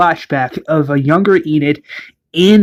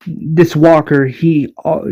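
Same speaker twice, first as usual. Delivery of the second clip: plain reading voice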